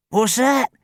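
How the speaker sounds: put-on high old man's puzzled query; elderly man, baffled. A narrator's reading. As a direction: ask as if hard of hearing. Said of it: "queried the man, frowning".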